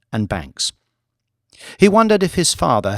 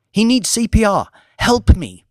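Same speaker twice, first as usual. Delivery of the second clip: much higher, frantic; desperate plea; 10 semitones higher, 4 dB louder than usual. Acted. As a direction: beg for help in panic.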